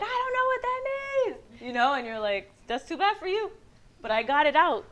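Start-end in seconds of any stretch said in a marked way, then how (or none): 0.0-1.4 very high mock-helpless voice; baffled protest. Husband cussed out in Spanish, high baffled protest. 2.7-4.9 higher, mock unbothered comeback; dismissive retort. Wife to baffled husband, dismissive mock retort.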